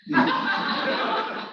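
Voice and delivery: breathy burst of real laughter. Real, not acted. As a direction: laugh at your own story. Laughing at own anecdote.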